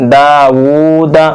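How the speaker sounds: "दाऊदा" slowly, with a brief final दा; measured, drawn-out "दाऊदा"; long vowels, short ending. Shown as a demonstration. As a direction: hold daa and oo, clip the last syllable of "दाऊदा". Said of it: "जबर को जल्दी पढ़ेंगे".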